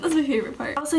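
ordinary talk, nothing marked

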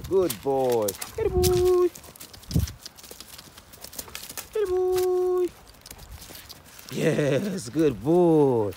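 very high squeaky dog praise; squeaky praising a dog. Real, not acted.

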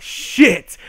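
loud, high exclamation; mock alarm. Hearing her footsteps coming, hammed-up alarm. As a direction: blurt it in comic dread.

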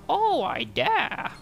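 very high, slow villain voice; cartoon villain voice. Voicing villain reply.

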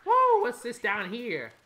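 high, loud whoa, then drawn-out question; startled and curious; surprised. Real, not acted. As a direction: react loudly to a sudden discovery. Startled by a find in game.

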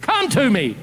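high acted yell; screamed challenge. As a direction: holler the challenge across a valley. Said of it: "He screams to him".

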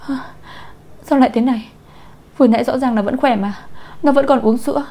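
panicked, rising protest; scared out of wits. A narrator's reading. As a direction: exclaim in frightened shock. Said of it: "cũng bị sợ hết hồn".

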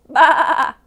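big high bleat, wavering; loud sheep baa. A narrator's reading. Storyteller voicing the duck for children, loud baa. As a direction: bleat it out as loud as you can. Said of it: "as loud as he could".

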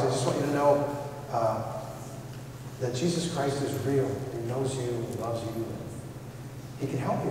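softly witnessing to the lawyer at the bar; quiet earnest witness. Retold or imitated. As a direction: lean in and tell him gently.

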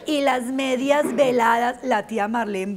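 normal talking voice

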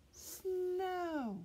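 Snow said slowly, stretched high; sounding out a word. Shown as a demonstration. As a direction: draw out the word Snow for little learners.

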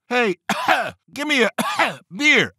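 high-pitched pony coughing between words; put-on hacking between high, halting words; acted coughing fit. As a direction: break up the order with mock coughs. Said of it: "walks into a bar and coughs".

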